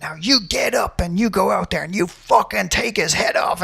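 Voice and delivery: high, driving pep talk in imitation; retold mock scream. Coach dad sending his fighter back out, mock scream. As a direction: drive the fighter on with a mock yell, volume held back. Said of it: "he's just screaming at Rob".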